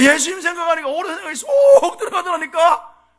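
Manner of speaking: loud, high and laughing; emphatic realization. Retold or imitated. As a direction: punch out the repeat with a long 쏙. Repeats the punchline emphatically, laughing. (웃음 섞어 힘차게 강조)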